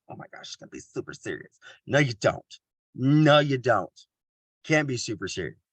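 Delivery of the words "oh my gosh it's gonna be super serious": anxious whisper, much softer, unvoiced; whispered worry. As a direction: whisper it like a nervous attendee dreading the session. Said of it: "They're like".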